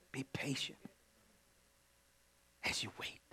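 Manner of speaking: hushed whisper. Real, not acted. Preacher whispering to the waiting congregation, hushed. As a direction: breathe it softly to them.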